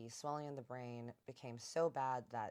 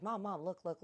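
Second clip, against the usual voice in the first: put-on eager kid tugging for attention, pitched up; a hint of a pleading little boy; child calling mom; 7 semitones higher, 5 dB louder than usual. Boy begging his mom to watch his trick, eager call.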